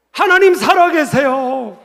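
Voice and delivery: high, loud, slow declaration; proclaiming shout. Real, not acted. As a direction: cry it out to the whole congregation. Climactic proclamation to congregation. (크고 힘차게 선포하듯)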